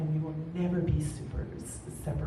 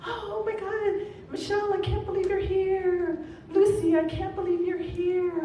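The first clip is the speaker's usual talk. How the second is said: high, loud delighted greeting; excited greeting; 13 semitones higher, 6 dB louder than usual. Retold or imitated. Nancy greeting friends at her party, high and loud. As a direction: gush over each friend arriving. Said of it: "She's like".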